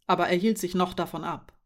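ordinary delivery

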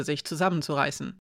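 plain reading voice

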